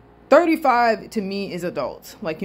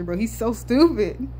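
ordinary delivery

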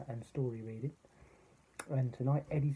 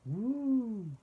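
high, loud wail from above; long hooting ghost noise; spooky storybook hoot, held long; ghostly woo; 11 semitones higher, 5 dB louder than usual. A narrator's reading. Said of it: "Then they heard a noise".

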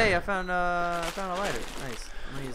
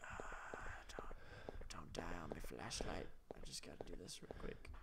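whispering; 10 semitones lower, 18 dB quieter than usual. Real, not acted. Hiding in horror game, whispering.

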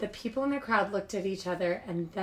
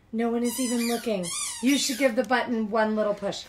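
plain reading voice

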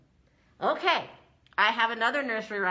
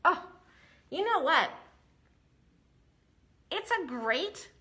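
ordinary talk, nothing marked

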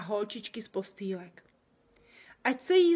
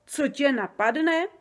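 higher, louder, slow, proudly repeating; proud echo; 6 semitones higher, 8 dB louder, slower than usual. A narrator's reading. Giant proudly echoing his own phrase back to Sofie, higher and slow. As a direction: savour the words with pride. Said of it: "opakoval Oberdobr pyšně".

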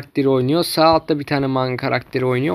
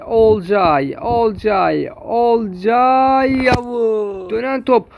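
play-by-play commentary; 5 semitones higher than usual. Acted. Live match play-by-play.

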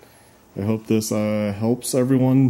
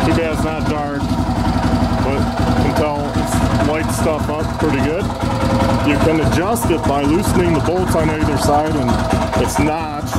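shouting over engine; 5 semitones higher than usual. Real, not acted. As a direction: talk up over the engine noise. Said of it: "Hope you can hear me over the engine".

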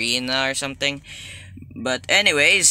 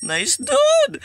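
falsetto nice dude; squeaky high voice; 5 dB louder than usual. Pretend. High far voice.

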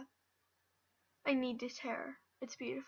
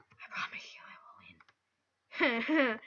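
slow breathy whisper of a promise; whispered vow; 4 dB louder, slower than usual. Real, not acted. Player whispers a vow to the viewers, slow. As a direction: swear it under your breath.

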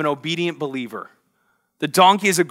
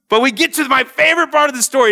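bursts in higher and louder, quick; excited; 8 semitones higher, 4 dB louder, faster than usual. Real, not acted. Preacher to congregation, excitedly reaching his favorite story. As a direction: lift the energy for the best part.

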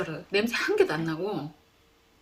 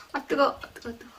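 sharp yelps at the heat; hot yelp; 7 semitones higher, faster than usual. Real, not acted. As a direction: yelp as the bone burns your fingers. Grabbing hot rib bare-handed. (뜨거워서 놀란 외침)

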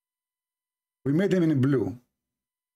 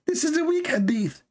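high mocking squeak; mocking critic; 12 semitones higher than usual. Mimicking the opponent who will dismiss it, high and mocking. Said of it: "what you will say to me".

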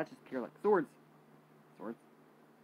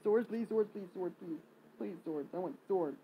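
pleading; 6 semitones higher, slower than usual. Real, not acted. Begging game for swords.

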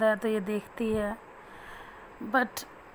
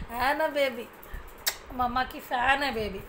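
normal talking voice

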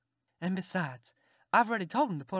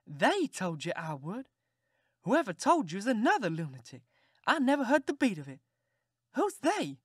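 rising to a high outraged squeal; incredulous aunt; 5 semitones higher than usual. Acted. Aunt disbelieving the boy's excuse, climbing high.

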